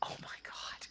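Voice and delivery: hushed what, oh my god; whispered disbelief. Acted. Silver staring at Nameless, whispering in disbelief. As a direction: breathe it in stunned disbelief. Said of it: "Silver looks at Nameless like".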